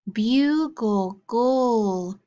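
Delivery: "bugle" then its ending "gul" alone, drawn out; sound card drill. Shown as a demonstration. Teacher drilling sound cards with the class, ending isolated. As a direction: say "bugle", then hold the last syllable "gul". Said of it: "get ready for our sound cards".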